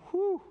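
high relieved exhale; relieved whew. Retold or imitated. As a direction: let out a big sigh of relief. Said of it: "It's like Moses is going".

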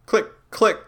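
two sharp mouth clicks, the second click with a faint L; click, click like a revolver hammer; gun cocking clicks. Shown as a demonstration. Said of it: "subtly in the second click".

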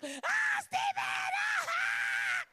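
very high, loud laughing shriek. Real, not acted. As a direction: scream with laughter.

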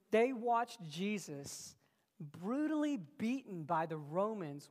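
loud, rising preacher's build; preaching at full force. Acted. Preacher to the congregation, building to a loud climax. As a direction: drive it home to the congregation.

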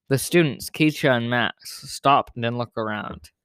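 ordinary delivery

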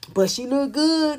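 drawn-out mock defending voice; mocking imitation. Mimicking people who defend Kylie Jenner, mocking. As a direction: imitate fawning fans, stretching the words.

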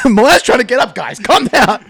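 comic shouting over the bouncing; mock exasperated calling out. Struggling to get up among kids, mock shouting.

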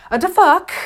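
high, loud, put-on yelp of shock; startled what the fuck. Woman whose partner moved wrong, mock startled cry. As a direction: toss it off like a jolted lover caught mid-moment. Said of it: "they're like".

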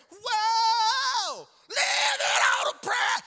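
very high, drawn-out holler; straining high note. Acted. Preacher acting out a prayer of a shot, straining high holler. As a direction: belt it out far above your range. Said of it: "I can't hit that note".

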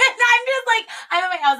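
high, loud, squealing through laughter; laughing. Real, not acted. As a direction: barely get it out for laughing. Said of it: "I'm dying laughing".

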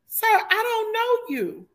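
high, slow, incredulous "Sir, I don't know you"; mock-shrill brush-off; exasperated. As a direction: push him off in disbelief. Exasperated reply to pushy stranger.

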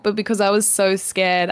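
normal talking voice

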